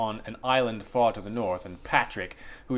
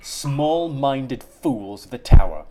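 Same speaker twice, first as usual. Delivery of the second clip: slow, louder contemptuous snarl; 3 semitones higher, 7 dB louder, slower than usual. Acted. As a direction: spit out the insult with scorn. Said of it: "She snarls".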